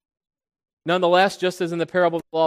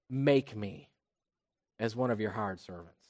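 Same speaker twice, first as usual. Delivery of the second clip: much softer and lower, humbled; humble plea; 8 semitones lower, 11 dB quieter than usual. Acted. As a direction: hush it like a broken son begging. Humbled son returning to father.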